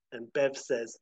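ordinary delivery

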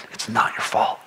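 hushed, unvoiced whisper of comfort. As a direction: whisper it gently to someone hurt. Comforting an abused person.

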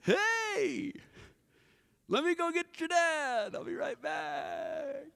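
backing away nervously, voice trailing off; awkward servant. Retold or imitated. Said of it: "you're like".

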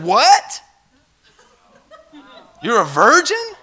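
loud, high, incredulous "What? You're a virgin?"; shocked disbelief. Retold or imitated. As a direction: blurt it out like a stunned preacher with a half laugh. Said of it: "he was so shocked".

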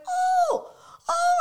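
very high, loud ooh; squealed mock surprise; sudden realisation. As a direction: act out the penny dropping. Acting out her sudden realisation.

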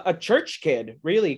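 ordinary delivery

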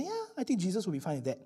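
quick, soft mock agreement; imitated offhand agreement, hurried and soft; casual self-justifying. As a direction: toss it off softly, imitating easy self-approval. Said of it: "you say".

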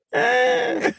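high-pitched noncommittal squeak; dismissive "eh". Shown as a demonstration. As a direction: squeak out a verbal shrug. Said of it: "shrug my shoulders and be like".

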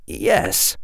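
unvoiced, distracted yes; absent reply. A narrator's reading. Harry answering the witch while deep in thought, absent murmur. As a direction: answer with your mind elsewhere. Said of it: "Harry said absently".

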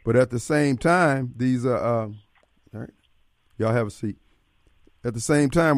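normal talking voice

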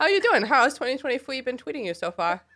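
put-on high, childish baby voice; baby talk. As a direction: coo it like a toddler. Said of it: "talk in baby talk".

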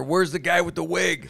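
high, loud mock complaint; demanding fan. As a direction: imitate a fan demanding the character. Voicing followers who demand the character.

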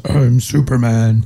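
slow robot-giant voice; drawn-out movie-robot line, playfully imitated; Iron Giant impersonation. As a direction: do an amateur take on a slow-talking giant robot. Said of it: "my best Vin Diesel Iron Giant impersonation".

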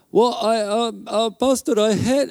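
badly attempted South African, sliding toward Indian; attempted South African accent. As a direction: haltingly imitate a South African man. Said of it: "trying to do a South African accent".

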